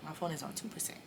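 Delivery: whispered. Real, not acted. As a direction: whisper it to yourself. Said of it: "I remember this so vividly".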